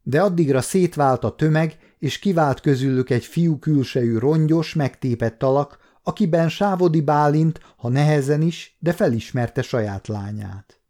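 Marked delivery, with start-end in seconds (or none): none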